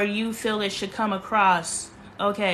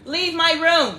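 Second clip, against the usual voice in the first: firm order; 5 semitones higher, slower than usual. Real, not acted. First order to leave room.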